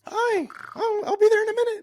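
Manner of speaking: very high put-on little voice; squeaky mock falsetto. Joking reply about coming over, squeaky mock falsetto. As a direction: pipe it up in silly falsetto. Joke opposite of raspy voice.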